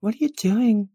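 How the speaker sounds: put-on very high, girly nervous awe; high-pitched flustered onlooker; awed valley girl. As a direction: ask timidly in a high girlish voice.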